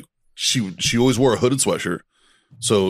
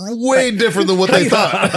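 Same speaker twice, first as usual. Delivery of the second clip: laughing; 12 semitones higher, 6 dB louder than usual. Real, not acted. Building joke, laughing.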